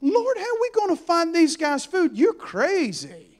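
high-pitched complaint, last words stretched; incredulous protest. Acted. Disciples told to feed the crowd, incredulous protest.

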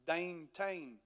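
rough imitation of an Edenton country accent; bad Edenton accent. Shown as a demonstration. Preacher mimicking the Edenton accent for the congregation, badly faked local accent. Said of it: "That's a bad version of it".

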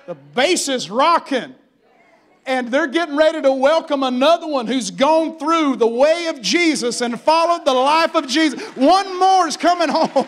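loud, high, ringing preacher's cry; shouting celebration preaching; preaching at full force. Acted. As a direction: preach it out at the top of the lungs.

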